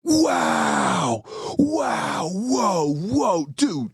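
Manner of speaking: hammed-up awed gasping at a great question; stunned wow. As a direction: imitate a star caught completely off guard. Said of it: "he goes".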